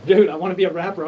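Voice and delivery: loud, punchy rapper protest; mock shout. Yelling at the crowd that he wants to rap, loud.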